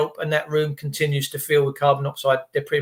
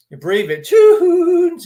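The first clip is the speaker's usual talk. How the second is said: high, loud advert announcer call of the brand name; 11 semitones higher, 6 dB louder, much slower than usual. Retold or imitated. Recalling a telly advert, sings out the brand name. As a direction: belt it like an old telly ad.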